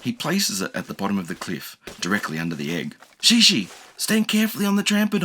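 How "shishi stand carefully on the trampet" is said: put-on eager high kid instructing; young dinosaur giving instructions. A narrator's reading. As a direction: direct her carefully. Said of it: "Enki instructs".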